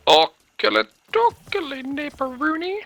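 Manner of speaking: very high silly sing-song; Ned Flanders voice. Pretend. Flanders-style silly reply.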